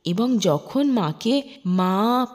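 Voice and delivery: ordinary delivery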